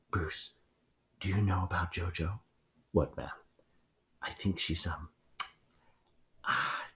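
hushed secret talk in a closet, voices barely there; whispering. Retold or imitated. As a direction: lean in and whisper the gossip. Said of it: "they're whispering".

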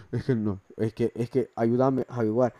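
lightly voiced grandpa tone; a hint of an old grandfather voice; old man's voice. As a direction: speak like someone's grandfather. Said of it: "parecía la voz de tu tata".

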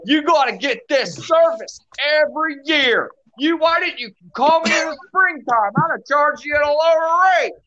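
high, scolding voice of a know-it-all repairman. Retold or imitated. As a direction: lecture the customer from up high. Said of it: "he starts scolding me".

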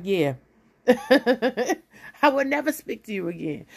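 laughing, slow and mocking. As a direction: laugh through the line. Inner voice, laughing.

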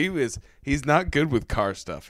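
ordinary talk

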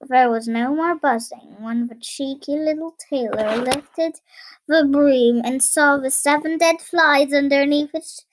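ordinary delivery, nothing marked

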